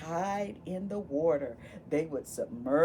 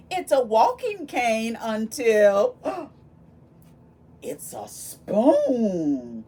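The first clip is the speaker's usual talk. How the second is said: gasp, then a very slow, loud it's a spoon; gasped reveal; 2 semitones higher, 9 dB louder, slower than usual. Acted. Teacher teasing the next page to the children, gasp and slow loud reveal. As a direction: hold the kids hanging, then drop the word slowly.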